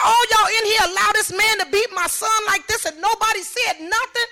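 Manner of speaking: high, loud re-enacted challenge; outraged accusation. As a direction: take the whole room to task. Confronting room full of police.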